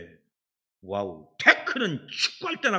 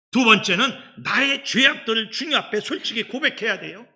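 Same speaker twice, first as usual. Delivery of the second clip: normal talking voice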